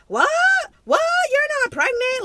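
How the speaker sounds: shrill high shocked yell; a boyfriend yelling in shock from the bathroom; retold scream. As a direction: shout it in disbelief. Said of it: "Like, screaming in the bathroom".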